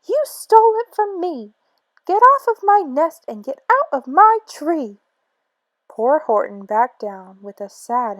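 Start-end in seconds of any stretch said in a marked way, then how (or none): 0.0-5.0 much higher, furious scolding; angry shrieking. Acted. Maisie ordering Horton off her nest, angry shriek. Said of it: "she sputtered".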